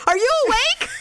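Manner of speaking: high, laughing sing-song question; bright little voice shining a light in his face; chirpy wake-up call. Retold or imitated. Wife flashing the light at sleeping Daryl, high and giggly. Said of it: "I go".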